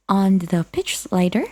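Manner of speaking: usual speaking voice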